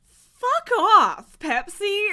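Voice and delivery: high, loud indignant shout; exasperated yell, bursting out; angry outburst. Real, not acted. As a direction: yell it at the company in outrage. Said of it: "That makes me so angry".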